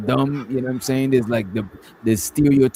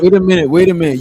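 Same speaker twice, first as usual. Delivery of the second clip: higher, louder cut-in; loud, insistent bid for the floor; urgent interjection; 4 semitones higher, 6 dB louder, faster than usual. Real, not acted. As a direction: break into the argument, raised voice. Cutting into a shouting match.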